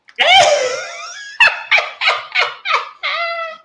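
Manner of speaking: shrieking high laugh; high cackle. Real, not acted. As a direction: let a falsetto cackle rip. Cackling at own joke.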